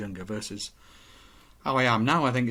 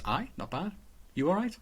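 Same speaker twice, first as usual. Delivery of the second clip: light, higher casual brush-off; a hint of a cheery colleague, higher; breezy small-talk reply; 6 semitones higher, 5 dB quieter than usual. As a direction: toss it off like break room chit-chat. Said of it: "what you wanted was".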